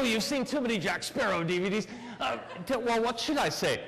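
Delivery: ordinary delivery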